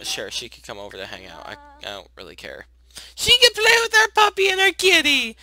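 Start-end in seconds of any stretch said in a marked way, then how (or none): 3.1-5.4 high-pitched excited cooing; cute gushing. Real, not acted. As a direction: squeal with delight about pets. Gushing over pets.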